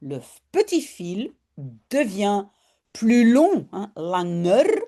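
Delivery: ordinary talk, nothing marked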